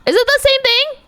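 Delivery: very high-pitched, thrilled question; excited squeal. Real, not acted. Streamer realising what song is coming, excited squeal. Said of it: "I'm so excited".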